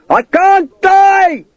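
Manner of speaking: very high, drawn-out cry; triumphant shout. A narrator's reading. Fallen man to enemy above, triumphant long high shout. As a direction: howl it slowly in triumph. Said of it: "he shouted triumphantly".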